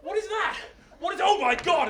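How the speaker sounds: high, rising-panic exclamation; alarmed disbelief. Acted. Hercules spots a shape running at him, alarmed disbelief. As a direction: react in mounting alarm. Hercules sees attacker charging.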